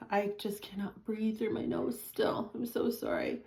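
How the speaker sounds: hushed apology under breath; whispered aside. Real, not acted. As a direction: drop to a whisper.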